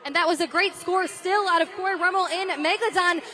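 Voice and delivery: ordinary delivery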